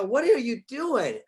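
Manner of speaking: high, loud, drawn-out parental disbelief; incredulous retold outburst, stretched out; mock exasperation. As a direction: exclaim it like a flabbergasted dad. Retells confronting his son.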